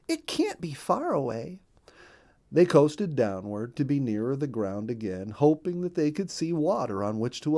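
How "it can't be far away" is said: hopeful wife. A narrator's reading. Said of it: "said Missus Mallard".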